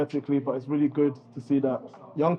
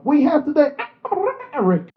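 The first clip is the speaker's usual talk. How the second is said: loud, high DJ shout-out; DJ hype call; 11 semitones higher, 7 dB louder than usual. Shown as a demonstration. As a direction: hype it like a DJ drop. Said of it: "And then you could do that".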